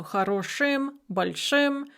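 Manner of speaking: "хорошим, большим" with hard ы after ш; hard vowel after sh. Shown as a demonstration. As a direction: say the endings of "хорошим, большим" as "шым". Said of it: "we will pronounce it as".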